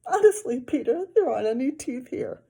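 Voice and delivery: put-on high, loud pleading girl. A narrator's reading. Girl insisting to Peter there are no teeth. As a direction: insist desperately.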